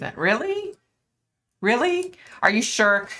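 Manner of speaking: high, rising "really?" in disbelief; can't believe what she just read; surprised disbelief. Real, not acted. Reacting to a chat comment.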